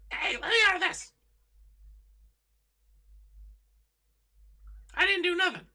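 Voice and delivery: very high, loud, squeaky rat voice; a tied-up were rat squeals at the guards, frantic and very high; squealing were rat. Acted. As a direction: squeal it like a panicked trapped rat. Captured were rat wriggling frantically.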